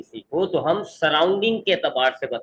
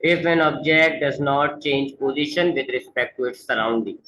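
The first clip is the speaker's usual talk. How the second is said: the full definition read in one slow, steady run; slow read-through; much slower than usual. Shown as a demonstration. Teacher reading the definition back to students in one slow, unbroken run. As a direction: read it back slowly so students can check. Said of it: "reads the whole definition through".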